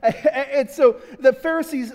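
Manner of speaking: words bubbling out through a laugh. Real, not acted. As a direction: chuckle while starting the story. Preacher chuckling into story.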